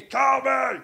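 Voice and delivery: wild, high shouted puppet voice. Retold or imitated. Doing the Muppets' duet of Call Me, wild high shout. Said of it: "they did Call Me with Animal".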